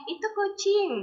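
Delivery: high, loud mock baby voice; pretend talking baby. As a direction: pipe up like a baby naming something. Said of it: "apakah si bayi itu akan ngomong". (suara bayi pura-pura, tinggi dan keras)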